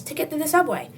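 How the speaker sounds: ordinary delivery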